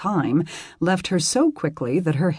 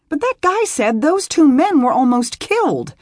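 high, loud alarmed protest; worried and indignant; upset; 11 semitones higher, 6 dB louder than usual. Acted. Beth upset.

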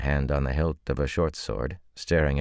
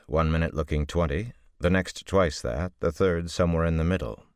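ordinary delivery

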